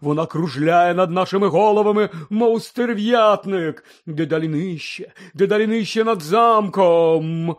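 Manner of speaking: loud, drawn-out seer's proclamation; ominous prophecy. Acted. A seer warning of doom circling ever nearer, ominous. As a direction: intone doom with long, stretched words.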